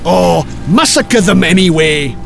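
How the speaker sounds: careless Viking command; hammed-up dismissive raider; offhand order. A narrator's reading. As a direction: order it with a shrugging 'oh'. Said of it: "horrible Hastin ordered".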